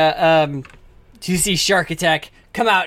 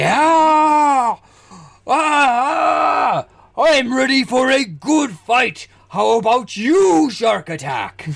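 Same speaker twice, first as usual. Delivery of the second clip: high, slow hollered wrestler boast; roar, then challenge your rival word by word; hammed-up big champion voice; the Great Lee Call; 6 semitones higher, 3 dB louder, much slower than usual. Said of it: "he's like".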